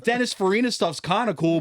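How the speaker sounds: ordinary delivery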